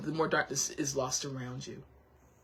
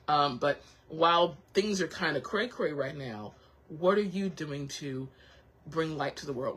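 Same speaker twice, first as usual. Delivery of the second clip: ordinary talk, nothing marked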